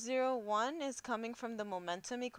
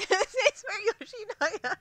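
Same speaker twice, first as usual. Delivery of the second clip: laughing; 12 semitones higher, 11 dB louder, slower than usual. Real, not acted. Cracks up reading a viewer's joke.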